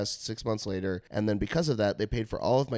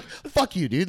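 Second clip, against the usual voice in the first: higher, half-joking jab at a friend; mock annoyed; 6 semitones higher, slower than usual. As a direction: snap at him playfully. Mock yelling at stoned friend.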